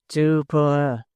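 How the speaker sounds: ordinary delivery, nothing marked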